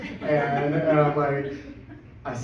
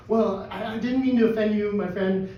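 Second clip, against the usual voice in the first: raised-pitch placating retelling; anxious apology; 7 semitones higher, 4 dB louder than usual. Retold or imitated. Apologizing to an offended stranger, anxiously placating. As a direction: act out a hurried apology, voice lifted.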